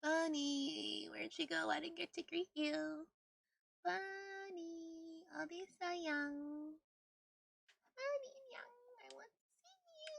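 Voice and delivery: high, drawn-out sweet calling; calling a bunny. Real, not acted. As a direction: coo after a pet, stretching the name.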